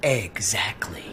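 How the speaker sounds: low hushed whisper. Acted. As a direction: breathe it, slow and secret. Conspiratorial agreement with friend.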